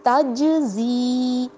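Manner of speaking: تجزی with the جیم bounced and the ی stretched slowly; echoing qalqala. Shown as a demonstration. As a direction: bounce the جیم and hold the final vowel. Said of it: "تو ہم کیا پڑھیں گے؟".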